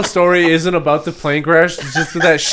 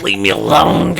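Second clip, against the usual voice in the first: growl voice; 5 semitones lower, slower than usual. Retold or imitated. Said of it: "growl voice".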